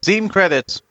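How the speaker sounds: high, pushy Jawa pidgin; squeaky trader voice, broken speech; broken Basic. Acted. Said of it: "als würde ich schlecht Basic sprechen".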